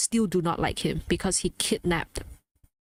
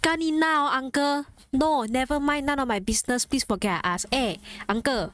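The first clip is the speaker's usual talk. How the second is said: exasperated rant in a Malaysian accent; 5 semitones higher than usual. Real, not acted. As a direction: let the Malaysian English slip out, higher at the start. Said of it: "the Malaysian accent is coming out".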